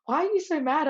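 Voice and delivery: put-on high, puzzled child's voice; daughter's question. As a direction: ask it like a baffled little kid. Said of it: "she was like".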